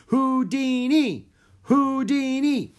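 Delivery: high, loud, slow chant; crowd shouting. A narrator's reading. Audience demanding Houdini, chanting loudly. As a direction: shout the name like an impatient crowd. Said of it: "had turned to shouting".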